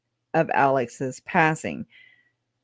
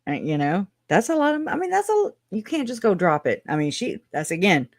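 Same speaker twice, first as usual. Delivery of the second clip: ordinary delivery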